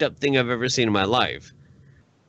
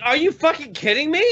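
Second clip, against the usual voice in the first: high, rising disbelieving question; real incredulity; 12 semitones higher than usual. Real, not acted. Answering a friend who asks about photos, incredulous. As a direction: squeal it in outraged disbelief. Asked if he has seen photos.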